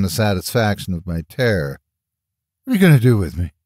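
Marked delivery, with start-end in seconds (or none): 2.6-3.5 mildly angry demand; a touch of scared defiance; angry over fear. A narrator's reading. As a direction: demand to know, masking fear. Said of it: "sound more angry than scared".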